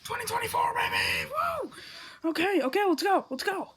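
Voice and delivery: cheering shout. Acted. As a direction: scream with joy at midnight. Midnight celebration, +12 dB shouts.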